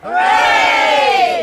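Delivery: long, loud, drawn-out hooray; children and villagers roaring back a cheer; crowd cheer. Acted. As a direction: shout it back with everyone.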